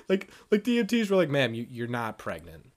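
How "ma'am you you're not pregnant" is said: low, soft imitation of a paramedic; matter-of-fact EMT. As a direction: gently break it to her, pitched down. Acting EMTs correcting her.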